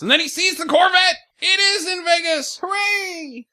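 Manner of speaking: excited shout. Acted. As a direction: shout it like a huge discovery. Excited retelling of the find.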